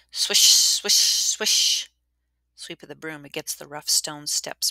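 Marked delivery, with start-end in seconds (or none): 0.1-1.9 loud breathy sweeping sounds; whispered swish noises. Acted. Narrator imitating the broom on stone steps, loud whisper. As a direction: hiss out each swish like a broom stroke.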